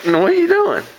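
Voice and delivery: high cooing question. Real, not acted. Mother cooing at toddler on lap, high baby talk.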